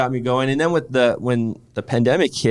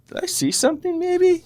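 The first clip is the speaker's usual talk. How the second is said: much higher, puzzled question; high wondering voice. Retold or imitated. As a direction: float it up in disbelief. Retold wondering thought as adult.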